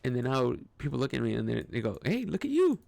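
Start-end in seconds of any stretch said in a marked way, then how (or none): 2.0-2.8 high, loud, hyped-up compliment; imitated admiring greeting. Acting out people noticing the look, loud admiring greeting. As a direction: call it out high like an impressed friend.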